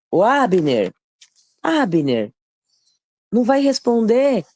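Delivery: drawn-out call across a distance, not full volume; mock shouted call. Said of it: "aí Davi começou a gritar, chamando".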